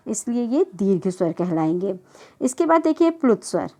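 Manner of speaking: ordinary talk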